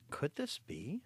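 usual speaking voice

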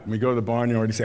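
ordinary delivery

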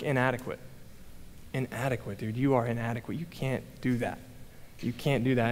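low, softened inner voice of self-doubt; subdued, defeated muttering to oneself; negative self-talk. Retold or imitated. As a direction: put yourself down quietly, pitch dropped. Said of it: "the word that kept coming in my head".

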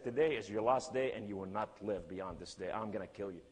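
ordinary delivery

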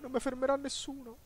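high squeaky boasting voice; mock boast. Gamer teasing friends, boasting in a squeaky voice. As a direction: brag in a silly high voice.

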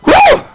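high ecstatic whoop; joyful whoop. Real, not acted. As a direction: let out a shout of pure joy. Overcome with joy at the scene.

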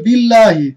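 বিল্লাহি with a thin light লাম; recited model word. Shown as a demonstration. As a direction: say it with the thin lam. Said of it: "কি হবে?". (পাতলা লামে উচ্চারণ)

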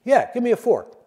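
a cannibal who can't believe the request; surprised cannibal. Retold or imitated. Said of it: "The cannibal says".

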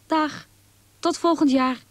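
high, lightly called goodbye; called-out farewell. A narrator's reading. Bird friends leaving for the south, calling farewell. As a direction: call a cheery goodbye upward. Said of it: "riepen ze".

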